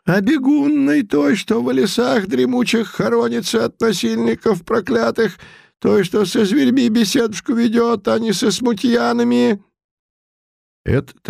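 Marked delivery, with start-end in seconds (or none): 0.1-9.6 put-on high mumbling old man voice; toothless mumble. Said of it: "продолжал шамкать Путилин".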